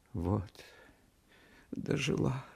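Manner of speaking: soft, tearful lament rising high; hushed, broken-hearted mother; through tears. A narrator's reading. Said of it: "проговорила она сквозь слёзы".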